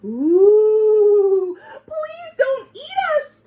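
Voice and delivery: high, pleading mock cry; scared crying. Dogs begging the monster not to eat them, high scared wail. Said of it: "cried the dogs".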